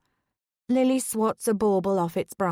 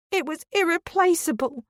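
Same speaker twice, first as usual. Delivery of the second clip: high, tearful cry; rising to a shout in grief; high, distraught outburst; upset shout; 9 semitones higher than usual. A narrator's reading. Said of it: "Dawn has stood up now and is shouting".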